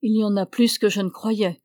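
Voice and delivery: plain reading voice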